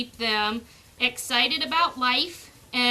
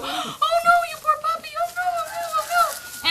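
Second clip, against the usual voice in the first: much higher, hammed-up distressed cry; mock a panicking owner; hammed-up overwrought dog owner wailing; overprotective owner. Shown as a demonstration. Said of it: "blow it out of proportion and go".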